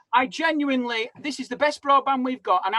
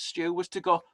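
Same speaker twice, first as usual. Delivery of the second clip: normal talking voice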